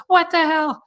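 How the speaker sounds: very high, loud shocked cry; startled by the bug landing in the laundry; shocked outburst. Retold or imitated. Girlfriend crying out as the centipede flies into her laundry. Partner hit by flying centipede.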